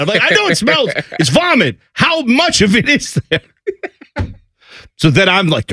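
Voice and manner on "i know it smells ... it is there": high-pitched impatient retort; retold exasperation with pitch jumping up; exasperated parent. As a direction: demand details, at your wits' end. Exasperated dad questioning about vomit.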